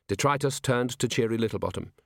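usual speaking voice

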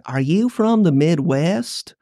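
slow, drawn-out Alabama drawl; Southern Alabama accent. Retold or imitated. Alabama local questions a newcomer, in a Southern accent. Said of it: "when I worked in Southern Alabama".

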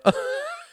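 a sudden burst of high laughter; amused laugh; delighted high laugh. Acted. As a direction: break into helpless giggles. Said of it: "Klaus laughed".

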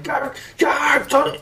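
high-pitched imitated crying shout; hammed-up anguished yelling; mock desperate cry. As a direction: act out frantic pleading, voice way up. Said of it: "He's like".

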